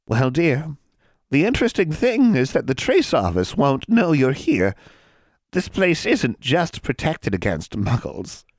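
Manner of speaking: put-on high creaky old hag; sly witch purring at a girl. Hag voiced in story.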